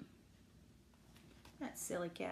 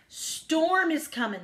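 mildly urgent, loud and high; animals' warning; 6 semitones higher, 5 dB louder, slower than usual. A narrator's reading. Animals telling the sleepy cat, urgent warning. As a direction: draw out the warning a little. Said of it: "the animals told her all at once".

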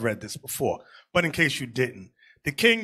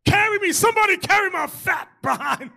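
much higher, louder hammed-up plea to be carried; mock pleading cry; 3 dB louder than usual. Mocking a winded brother to the church, hammed-up plea. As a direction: whine it like a man about to collapse. Tired brother begging for help.

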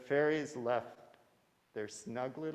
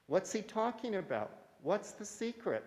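curious fairy voice; 9 semitones higher than usual. Acted. Puzzled fairy asks a question.

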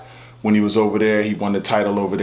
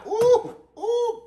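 very high squealing "ooh" with laughter; pained laughing squeals; 3 dB quieter than usual. Acted. Squealing at the burning heat, laughing through it. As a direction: squeal like it burns but it's funny. Reacting to something hot.

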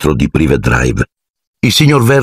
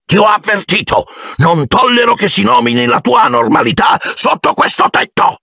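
high, furious roar; furious uncle. Acted. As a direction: bellow the warning. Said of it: "nello stesso tono". (zio furibondo che urla, voce alta)

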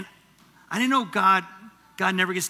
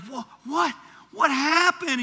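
higher, startled mock disbelief; bewildered parent; 10 semitones higher than usual. Parent seeing the kids start fighting, higher mock bewilderment. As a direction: act suddenly lost at the chaos. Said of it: "You're like".

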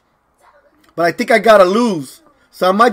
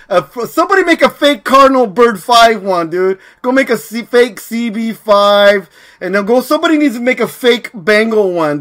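ordinary talk, nothing marked

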